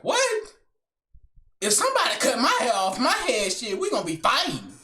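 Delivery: high-pitched mock outrage; hammed-up female indignation; imitated indignant girl. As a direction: act out an affronted girl with swooping pitch. Girl outraged at hypothetical haircut.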